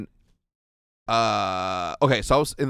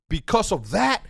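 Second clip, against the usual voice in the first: re-enacting an angry rant, high and raised; super mad outburst; 10 semitones higher than usual. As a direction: relive yelling at your teammates, pitch way up. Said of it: "fucking got me super mad".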